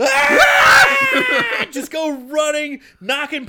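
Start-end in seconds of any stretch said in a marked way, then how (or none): 0.0-1.7 long, very high, loud comic scream; imitated shriek of terror; mock panicked scream. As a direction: scream like a fleeing crowd, hammed up. Imitating partygoers fleeing in panic.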